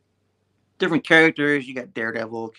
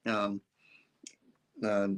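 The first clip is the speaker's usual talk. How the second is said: usual speaking voice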